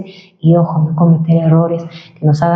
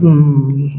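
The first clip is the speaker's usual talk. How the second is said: lowered steady "mmm"; low hum; 4 semitones lower than usual. Shown as a demonstration. Coach showing how to lower tone, low hum. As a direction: drop the cow-like hum down in pitch. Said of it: "intentar elevar o bajar".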